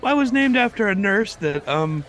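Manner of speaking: put-on high boy's voice, correcting his name; squeaky schoolboy protest; prepubescent boy voice. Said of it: "Your voice has gotten just more like".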